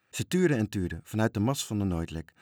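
ordinary delivery

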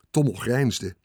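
ordinary delivery

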